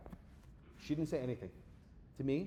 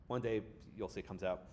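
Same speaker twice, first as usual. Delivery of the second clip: ordinary talk, nothing marked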